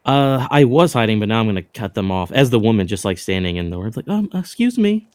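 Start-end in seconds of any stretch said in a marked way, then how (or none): 4.1-5.1 put-on high, slow sweet girl voice; disguised girl voice. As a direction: put on a timid young woman. Disguised woman steps in alley.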